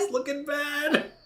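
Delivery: very high, drawn-out sing-song; sing-song verdict. Pretend. Game master confirming the fish looks bad, high sing-song. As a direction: draw it out in a high playful pitch. Repeats verdict on the monster's damage.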